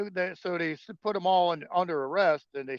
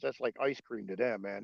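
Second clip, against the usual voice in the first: ordinary delivery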